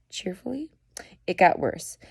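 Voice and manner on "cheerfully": breathless disbelief, hushed; incredulous whisper. Real, not acted. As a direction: whisper "Cheerfully?" in disbelief, rising at the end.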